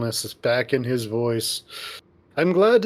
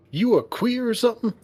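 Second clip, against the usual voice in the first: put-on southern accent; 9 semitones higher, faster than usual. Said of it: "I say it with a southern accent".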